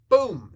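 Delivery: loud, high punch sound effect; imitated blow. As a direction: punch out a sudden boom.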